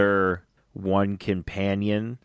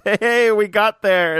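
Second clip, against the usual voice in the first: higher, louder, slow mock cheer; Nardole's cheer; 11 semitones higher, 5 dB louder, slower than usual. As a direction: ham up the sidekick's triumph. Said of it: "Nardole goes".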